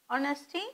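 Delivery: "honesty" with the H left silent. Shown as a demonstration. Teacher showing students how to pronounce it, "honesty" with silent H. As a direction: say "honesty" starting on the vowel, no H sound. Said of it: "H is mute".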